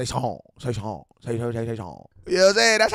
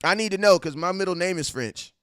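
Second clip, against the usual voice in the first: lightly voiced eager viewer, higher and louder; retelling a viewer's question about the French name; mock-eager fan; 8 semitones higher, 4 dB louder than usual. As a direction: imitate a curious fan pressing his question. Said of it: "He said".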